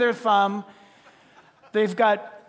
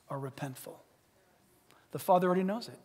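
ordinary talk, nothing marked

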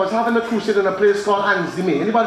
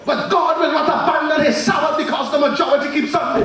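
full-force preaching; 5 semitones higher than usual. Acted. Preacher driving home God's truth to the congregation, shouting. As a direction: thunder it at the congregation.

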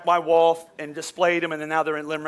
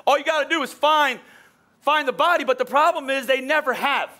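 raised, driving preacher's voice pushing each line; preaching at full force; 7 semitones higher, 4 dB louder than usual. Acted. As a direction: preach it hard to the congregation. Preacher building intensity.

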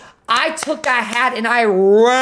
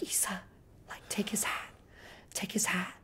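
urgent hushed whisper; 2 semitones lower, 20 dB quieter than usual. Retold or imitated. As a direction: breathe it out so nobody overhears. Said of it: "Cara is, like, whispering to me".

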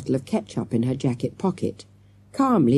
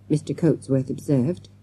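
ordinary delivery

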